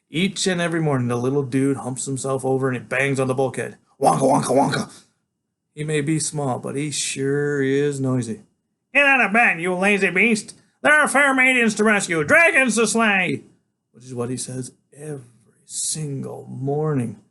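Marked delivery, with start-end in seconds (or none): 4.0-5.0 louder thudding wonka sounds; imitated banging. 8.9-13.4 higher, louder, grand little knight; small boy waking his big friend for a quest; eager boy genius. Acted. Freak's voice in story.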